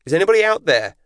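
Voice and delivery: high, loud searching call; calling out across the storm; yelled. A narrator's reading. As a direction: shout out for any answer. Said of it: "she yelled".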